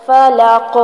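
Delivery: فلق with the final qaf bounced; فلق ending in qalqala; echoing qaf. Shown as a demonstration. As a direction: give فلق a little echo on the qaf. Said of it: "اس کو بھی ہلائیں گے".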